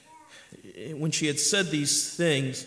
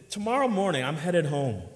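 ordinary talk, nothing marked

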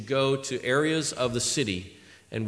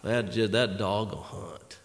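Joe Reese; 5 semitones lower, 4 dB quieter, slower than usual. Retold or imitated. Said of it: "nobody that can talk like Joe Reese".

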